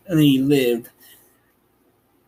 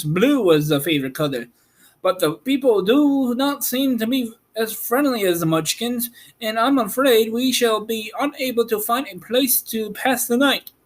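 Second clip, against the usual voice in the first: higher, worried straw man; Scarecrow voice; 9 semitones higher, faster than usual. Acted. Scarecrow to Dorothy, higher and worried. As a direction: voice the Scarecrow, a bit anxious.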